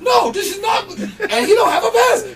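very high, loud mock protest; protesting guard. Security objecting to the roadie, protesting. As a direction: imitate an outraged guard shouting.

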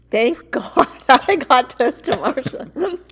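laughing through the words; laughing with relief. Real, not acted. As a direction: giggle it out, relieved. Relief at escaping the stink bug.

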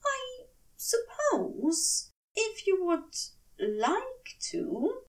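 slow, hesitant and unsure; doubtful. Acted. Aziraphale hesitantly accepting Crowley's offer, doubtful. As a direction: let each word hang, uncertain. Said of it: "he said doubtfully".

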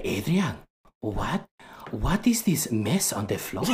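put-on accented grandmother scolding, held-in anger; mad grandmother. Grandmother scolds grandson over his messy room, mock mad. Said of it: "when she would get mad".

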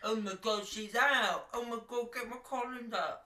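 high, excited Essex queen impression; Essex accent. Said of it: "Essex queen".

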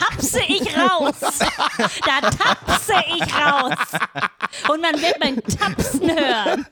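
"tapse" punched high, words stretched out slowly; mock-defiant, laughing through "tapse ich raus"; comic emphatic threat. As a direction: hammer "tapse" and "Tapsen" with silly grandeur.

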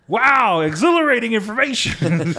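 loud, laughing mock awe; sarcastic laughing wow. Real, not acted.

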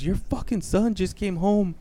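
high-pitched mock outrage; flipped-out mother. Mom phoning dad about their son, imitated high-pitched outrage. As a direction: report it like an exasperated wife. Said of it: "flipped the fuck out".

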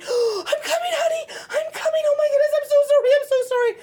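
high, loud, fast panicked calling; frantic parent calling from another room; panicked mom shouting apologies. Shown as a demonstration. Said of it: "They're hearing that panic".

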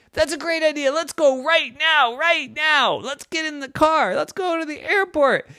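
high, bright eager mom voice; eager mommy. Acted. Mommy agrees to the trip, very eager. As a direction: sing it up high like an excited mother.

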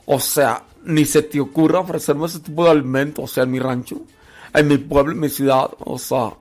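mock snooty outrage at being offered nopales; snobby disgust. Picky snob mocking the offer of nopales, hammed-up disgust. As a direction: sneer like a spoiled city girl insulted by peasant food. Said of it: "que le digan".

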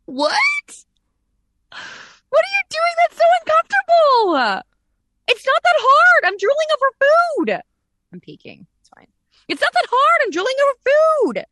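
very high, loud put-on whine; cartoonish high-pitched indignant squeal; squeaky exasperated whine. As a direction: shriek it as if the task is easy. Mock exasperated reply, acted.

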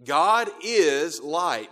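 slow, loud, with "is" stretched out; stress on IS. Shown as a demonstration. Preacher repeats the verse to the congregation, stress on "is". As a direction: hit and hold the word "is". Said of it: "I emphasize the word "is".

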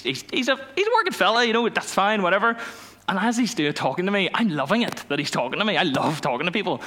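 ordinary talk, nothing marked

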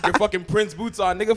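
usual speaking voice

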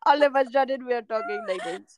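imitating a slip into an Indian accent; mock Indian accent. Cohost teasing friend's drifting accents, mock Indian accent. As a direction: tease with a put-on Indian accent. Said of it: "like, literally Indian".

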